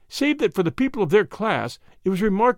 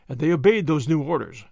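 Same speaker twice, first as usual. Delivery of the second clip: usual speaking voice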